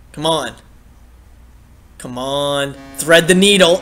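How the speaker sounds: ordinary talk, nothing marked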